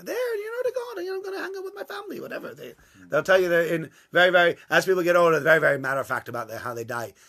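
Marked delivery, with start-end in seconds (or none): 0.0-2.2 very high, fast, airy put-on old-timer voice; old person's voice. Elderly congregant cheerfully saying he's off to God, very high and fast. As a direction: squeak it out like a cheerful old man off to a party. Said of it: "They just say".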